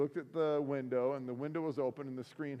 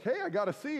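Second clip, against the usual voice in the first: much higher, loud mock pride in "Hey, I got a C"; proud; 10 semitones higher, 4 dB louder than usual. Joking to the dean about his tennis grade, mock proud. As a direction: show off the one C like a small win. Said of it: "And I was like".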